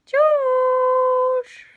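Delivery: high loud call to a cat. Real, not acted. Owner calling her cat across the room, loud high call. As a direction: sing out the cat's name, high and loud.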